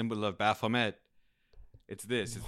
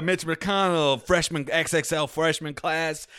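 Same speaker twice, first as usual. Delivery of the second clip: hype announcer; 4 semitones higher, 7 dB louder than usual. Pretend. Hyping an announcement.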